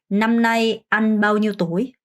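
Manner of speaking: slow, clear Vietnamese question; slow model phrase. Shown as a demonstration. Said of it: "문장 끝". (천천히 또박또박 시범 질문)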